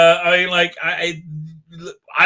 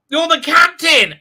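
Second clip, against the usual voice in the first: ordinary delivery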